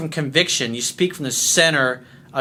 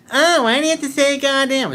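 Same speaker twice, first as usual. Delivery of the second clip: high-pitched, louder mock whine; mocking complainer; 10 semitones higher, 4 dB louder than usual. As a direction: imitate a fussy objector, pitched way up. Mimics complaining viewers mockingly.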